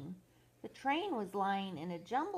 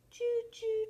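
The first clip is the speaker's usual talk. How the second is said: high, piping choo-choo like a train whistle; a child's train whistle; 4 dB louder than usual. Pretend. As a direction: toot it high like a toy train.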